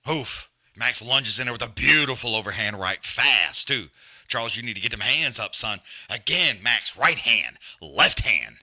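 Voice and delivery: loud ringside play-by-play, building with each punch; live fight commentary. Acted. Calling the boxing match round by round, loud and excited. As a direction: call every punch like a hyped announcer.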